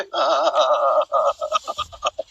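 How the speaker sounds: low, rattling staccato laugh; long cackle. Real, not acted. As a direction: cackle on and on in bursts. Laughs while pleading for support. (قهقهة طويلة متقطعة)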